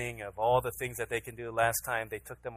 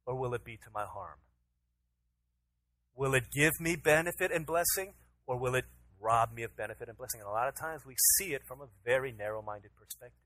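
ordinary talk

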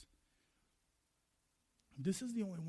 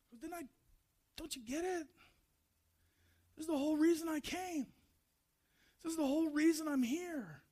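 acted exasperated appeal, pitched up; pleading insistence; 8 semitones higher, faster than usual. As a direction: urge them to finally understand. Jesus earnestly reproving Martha.

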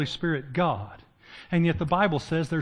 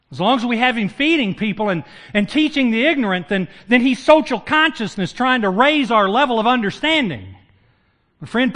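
louder and higher, building through the cross and resurrection; full-force preaching; 6 semitones higher, 8 dB louder than usual. Acted. Pastor to congregation, full-force preaching. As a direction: preach it out with rising fire.

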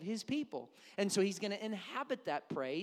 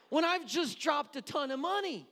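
high, loud comic exasperation; passionate; 8 semitones higher, 8 dB louder than usual. Acted. Dad who paid for the outing, passionately exasperated. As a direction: burst out over the wasted money. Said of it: "I get especially passionate".

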